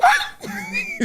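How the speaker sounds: high, sharp yelp; imitated dog yelp. Host showing the dog when pushed too far, high yelp. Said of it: "that's that dog doing".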